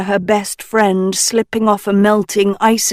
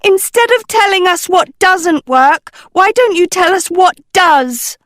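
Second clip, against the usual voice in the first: higher, loud impatient yell; 11 semitones higher, 5 dB louder than usual. Acted. Sophie yelling at the crew through the door. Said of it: "Sophie yelled through the door".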